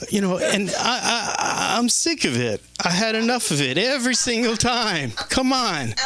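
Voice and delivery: usual speaking voice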